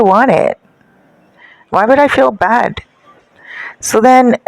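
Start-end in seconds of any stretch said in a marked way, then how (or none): none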